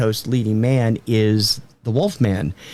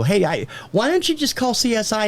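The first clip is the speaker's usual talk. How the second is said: imitating wife; 10 semitones higher than usual. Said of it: "she'll go".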